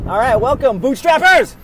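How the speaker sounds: loud, high hosting voice; show intro. Acted. Host welcoming Bootstrappers in a loud, high intro voice. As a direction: pump up the viewers like a show host.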